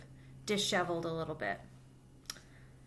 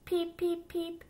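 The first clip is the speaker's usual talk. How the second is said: high squeaky little duckling peeps; baby duck peeping; 9 semitones higher, 4 dB louder, slower than usual. Acted. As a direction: chirp it out high like a tiny duck. Baby duck's peeps in story.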